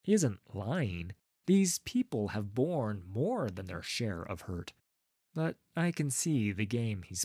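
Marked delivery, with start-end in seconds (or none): none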